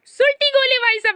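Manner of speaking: high, loud punchline shout; excited catchphrase. Acted. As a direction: call it out like a hype line. Catchphrase called out. (ऊँची, ज़ोरदार आवाज़ में punchline)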